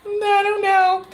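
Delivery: put-on a scared boyfriend whimpering at the kiddie pool; whiny scared kid. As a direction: whine like a child too scared to get in. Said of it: "And he's standing there like".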